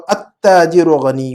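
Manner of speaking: التاجر read slowly as "at-taajiru"; silent lam. Shown as a demonstration. Teacher demonstrating the vanished lam, التاجر slowly as "at-taajiru". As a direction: drop the L and double the ت in التاجر. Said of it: "لام کی آواز کو غائب کر دیں گے".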